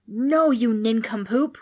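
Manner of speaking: loud, drawn-out exasperated wail; wailing ghost. A narrator's reading. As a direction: wail the insult slowly and loudly. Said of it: "wailed the ghost".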